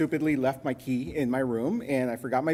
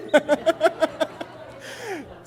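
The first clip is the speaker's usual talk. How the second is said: hearty high laugh; real laugh at his own pun; 3 dB louder than usual. Real, not acted. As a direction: burst out laughing.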